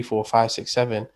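ordinary talk

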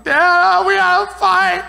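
high, whiny call to his dad; imitating his upset younger self. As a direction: plead like a hurt young man on the phone. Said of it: "I was so upset".

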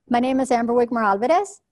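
rising tune at the end, as if asking; name said like a question, pitch lifting on Alvarez; uptalking. Shown as a demonstration. Speaker demos uptalk to audience, questioning rise. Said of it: "I'll give you an example of how it sounds when you do uptalking".

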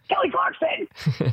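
high, stretched-out mock yell; pain yell. Yelling when hair rips.

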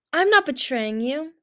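indignant denial; protesting. A narrator's reading. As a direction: protest loudly, drawing it out. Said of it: "Clearsight protested".

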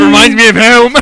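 hammed-up Australian surfer accent. Mock Aussie surfer reminiscing to mates, hammed-up Australian accent.

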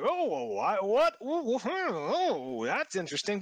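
high, hammy "oh well, hmm"; mock surprise. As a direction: act puzzled and suspicious. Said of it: "they were like".

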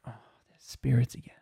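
whispered, resigned sigh of a line; weary whisper. Retold or imitated. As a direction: mutter it under your breath, fed up. Tired man annoyed by spirits.

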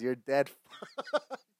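slow quote breaking into laughter; laughing. Real, not acted. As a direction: crack up mid-line. Cracks up while quoting the cow.

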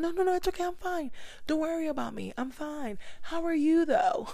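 high, bright brush-off ending in a laugh; fake cheerful. Drowning but telling friends she's fine, high and chirpy. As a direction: chirp that you're fine and turn it back on them. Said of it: "That's me".

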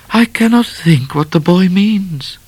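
high, airy dismissive lady's voice; airily to no one. A narrator's reading. Miss Laura brushes off Midnight, airily dismissive. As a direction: toss it off, ignoring him. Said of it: "to no one in particular".

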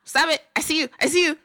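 mock scolding. Boyfriend scolding her for picking, mock loud scold. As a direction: call them out like a nosy parent. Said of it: "He's not like".

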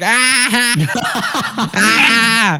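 high loud squealing ah with laughter; kid's mock scream. Fleeing kid screaming, high laughing mock shriek. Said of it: "the kid started screaming like".

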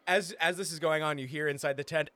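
usual speaking voice

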